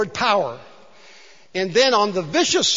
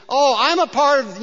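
higher, louder whine; whining Gideon; 6 semitones higher, 5 dB louder than usual. Acted. Preacher voicing Gideon's hand-wringing whine to God. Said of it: "and whines".

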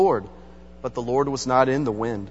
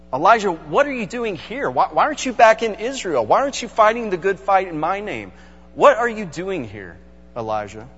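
higher, insistent voice of God; God asking; 4 semitones higher than usual. Retold or imitated. God demanding of the runaway Elijah, higher and pressing. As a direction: press him with the question, searching. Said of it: "is what God is asking".

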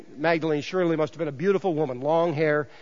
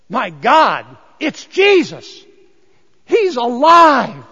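loud, higher and very slow, overwhelmed with joy; astonished joyful cry; 4 semitones higher, 9 dB louder, slower than usual. Acted. Mary recognizing the risen Jesus, loud astonished cry. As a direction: cry out in amazed disbelief.

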